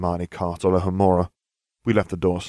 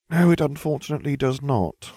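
sighing; 7 semitones higher than usual. Acted. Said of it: "I sighed".

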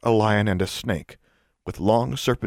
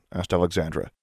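plain reading voice

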